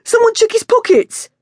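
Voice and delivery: high, loud call to the gang; eager shout. A narrator's reading. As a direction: urge the others to rob him. Gang member calling out.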